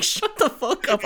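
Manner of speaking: mock retort. Own retort to Lisa.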